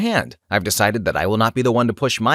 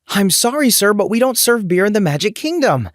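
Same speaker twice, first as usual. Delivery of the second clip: much higher, forced-cheerful refusal; put-on sugary customer-service voice; struggling to smile; 10 semitones higher than usual. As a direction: repeat the polite line through a strained smile. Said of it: "struggling to repeat myself with a smile".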